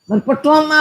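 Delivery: ordinary talk, nothing marked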